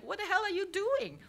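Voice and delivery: high, loud mock-English curiosity; curious manager. Curious manager watching her paint, high put-on voice. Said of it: "he's very curious".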